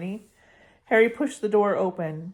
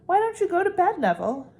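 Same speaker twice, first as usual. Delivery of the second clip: higher, pushy brush-off; flustered Hermione; 11 semitones higher than usual. Acted. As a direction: shoo him off to bed, nervously. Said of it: "said Hermione".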